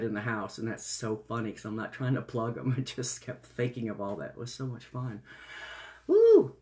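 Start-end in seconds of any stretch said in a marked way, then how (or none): 6.1-6.6 excited woo. Real, not acted. Excited cheer.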